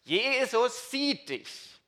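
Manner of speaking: high, very slow eerie parrot voice. Acted. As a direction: drag it out high like a spooky talking bird. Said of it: "wieder diese Stimme". (hohe, sehr langsame Papageienstimme)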